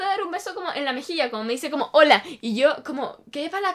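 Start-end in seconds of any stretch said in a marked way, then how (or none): 1.9-2.2 sudden loud, punched hello; aggressive greeting. Retold or imitated. Ex ambushing her at the mall, aggressively loud. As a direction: startle them with an abrupt, much louder hola. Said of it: "onda, agresividad".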